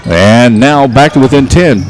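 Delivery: ordinary talk, nothing marked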